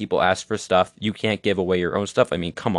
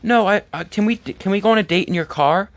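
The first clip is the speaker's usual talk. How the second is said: much higher, squeaky girlish mock voice; mock date; 11 semitones higher than usual. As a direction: play the date turning him down in a high voice. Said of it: "And she'll be like".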